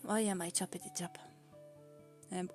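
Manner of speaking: normal talking voice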